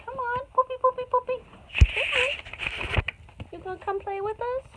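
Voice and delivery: high-pitched puppy-calling voice; squeaky come-here call; high pet coaxing. Real, not acted. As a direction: coax a puppy in a high little voice.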